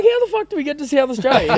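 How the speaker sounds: high-pitched mock bafflement; incredulous retelling. Drunk man questioning his mate about the found car, incredulous. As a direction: replay a baffled outburst, pitched right up. Acted baffled reply to friend.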